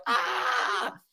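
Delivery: short high yell of disbelief; in the car after meeting Jesus, a startled yell; shocked yell. Retold or imitated. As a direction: burst out with an overwhelmed cry. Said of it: "and I'm like".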